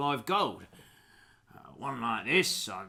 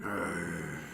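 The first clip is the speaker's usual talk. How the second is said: low dog snarl; long, low animal growl; mastiff growl; 8 semitones lower, 4 dB quieter than usual. Acted. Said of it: "the mastiff is going".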